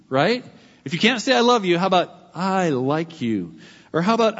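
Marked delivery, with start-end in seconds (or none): 2.3-3.6 drawn out slowly and kindly; slow, sincere little compliment; tender and slow. Shown as a demonstration. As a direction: offer it gently, like a gift. Preacher models a kind phrase.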